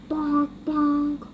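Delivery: ordinary delivery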